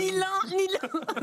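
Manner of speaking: mocking echo. Echoing the answer in mock voice.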